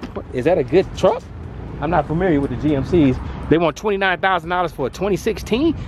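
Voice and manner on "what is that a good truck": normal talking voice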